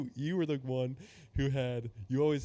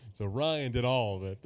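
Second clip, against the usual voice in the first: ordinary talk, nothing marked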